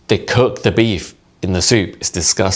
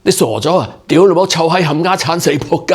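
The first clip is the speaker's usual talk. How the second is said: high, loud, forceful Cantonese tirade; passionate swearing, breath running out; 10 semitones higher, 4 dB louder than usual. Acted. Comedian demonstrating swearing at sentence end, forceful and running out of breath. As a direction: launch an acted angry rant, spending all the breath. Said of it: "you run out of breath".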